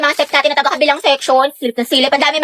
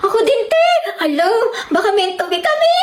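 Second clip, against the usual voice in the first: giddy; 5 semitones higher than usual. Acted. Girl gushing over shared game interest.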